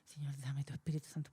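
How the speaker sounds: low, soft pleading prayer; quiet prayer. Retold or imitated. Teacher retelling her prayer to God: low, soft plea. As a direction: murmur it to God, humbly.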